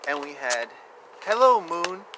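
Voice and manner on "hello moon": ordinary delivery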